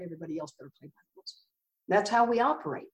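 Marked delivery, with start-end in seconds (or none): 0.0-1.3 rushed, much softer mock muttering; sarcastic aside. Mocking alcoholics who demand others follow rules, quick sarcastic aside. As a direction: toss it off quickly under your breath.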